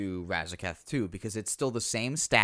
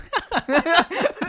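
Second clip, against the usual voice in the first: high laugh; 14 semitones higher, 7 dB louder than usual. Real, not acted. Laughing after ad joke.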